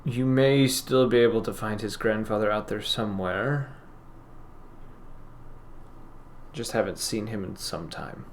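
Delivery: lightly voiced sad shopkeeper, softly; softly, with a hint of sorrow, halting pauses; sorrowful shopkeeper, subdued. Game master plays sorrowful shopkeeper.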